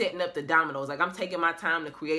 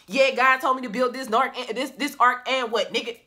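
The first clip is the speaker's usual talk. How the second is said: boastful Noah; 5 semitones higher, 6 dB louder than usual. Retold or imitated.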